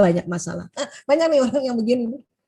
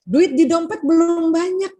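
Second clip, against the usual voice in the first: higher, louder, slow cheerful line; upbeat reframing; 7 semitones higher, 6 dB louder, slower than usual. Shown as a demonstration. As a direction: say it hopefully. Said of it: "bilang aja". (dengan nada optimis)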